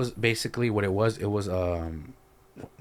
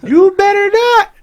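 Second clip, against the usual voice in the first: very high, loud, stretched threat; imitated warning yell; 16 dB louder, slower than usual. Mother warning the man off, mock screeched threat.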